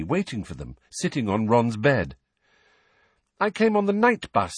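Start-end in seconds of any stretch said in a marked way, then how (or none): 3.4-4.5 airy, much higher voice. A narrator's reading. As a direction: toss it off airily. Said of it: "said Hermione airily".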